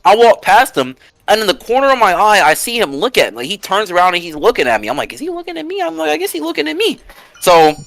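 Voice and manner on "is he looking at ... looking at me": unsure inner thought. Retold or imitated.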